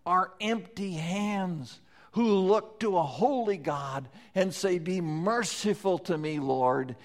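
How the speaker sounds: high, loud preaching voice; full-force preaching. Acted. Preacher exhorting the congregation, full-force preaching. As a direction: proclaim grace to the congregation.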